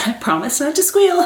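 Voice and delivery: laughing, squeaky rise at the end; mock squeal. Real, not acted. Vlogger vowing not to squeal, half squealing it. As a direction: pitch climbing high on "squeal". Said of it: "I hate that so much when people squeal".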